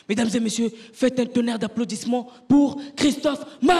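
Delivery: a mock emcee bellowing the name; announcer intro. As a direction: hype up the crowd for the act. Announcer introducing him on stage.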